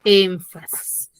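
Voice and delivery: énfasis drawn out slowly with a heavy first syllable EN; emphatic stress. Shown as a demonstration. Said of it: "la debo de pronunciar con mucho más".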